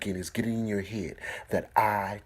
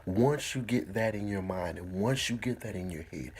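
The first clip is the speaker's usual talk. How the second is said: ordinary talk, nothing marked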